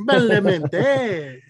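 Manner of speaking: mock Italian Badalamenti, drawn out; Italian accent. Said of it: "you say it with an accent".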